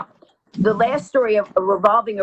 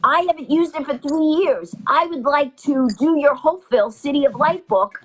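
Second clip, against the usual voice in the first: excited quote; 6 semitones higher than usual. Retold or imitated. Guy's excited realization retold.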